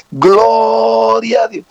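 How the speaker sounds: high, long-drawn cry of praise; mock shout. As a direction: imitate a worshipper suddenly crying out from the back. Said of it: "de pronto desde allá grita".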